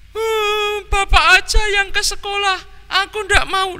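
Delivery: put-on very high whiny boy's voice; whining child. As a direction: whine and refuse like a sulky child. Tony whines, refusing school. (anak merengek menolak)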